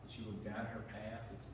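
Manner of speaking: ordinary delivery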